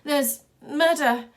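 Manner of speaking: slow, loud, halting cry; broken shout. A narrator's reading. Wild Boy, shaking and breathless, struggling to shout to the crowd. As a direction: force the words out with effort. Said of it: "words didn't come out properly".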